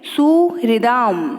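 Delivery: सुहृदाम very slowly, हृ said as "hri"; clearly sounded "ri". Shown as a demonstration. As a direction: draw out सुहृदाम so the "ri" is heard. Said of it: "री का उच्चारण भी होना चाहिए". (सुहृदाम में री का स्पष्ट, धीमा उच्चारण)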